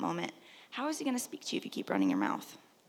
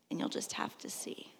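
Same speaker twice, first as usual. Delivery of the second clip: hushed whisper; 12 dB quieter than usual. Real, not acted. Preacher whispers God's answer to wait.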